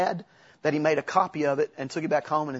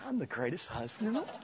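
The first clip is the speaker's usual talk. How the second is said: mock wife; 8 semitones higher, 9 dB quieter, faster than usual. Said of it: "she was just, went".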